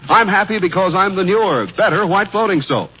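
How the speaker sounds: normal talking voice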